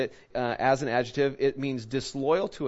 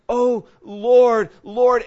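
loud, high pleading cry to God; acted anguished prayer, high and loud; mock tearful cry; 8 semitones higher, 10 dB louder, much slower than usual. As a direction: cry out as if praying through tears. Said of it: "we're crying, we got the tears running".

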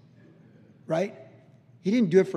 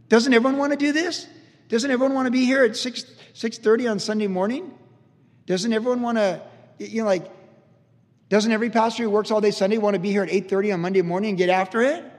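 higher and louder, hammed-up enthusiasm; mock eager pastor; 6 semitones higher, 7 dB louder than usual. Pastor urging staff to work Sundays, mock eager and louder. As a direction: lightly imitate a pastor cheerfully selling extra work. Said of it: "Pastor Chuck expected people to be on staff".

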